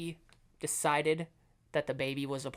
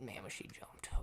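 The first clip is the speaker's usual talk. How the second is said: whispered, much softer aside; 8 semitones lower, 16 dB quieter than usual. Real, not acted. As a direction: breathe it out in a whisper. Hushed shocked aside.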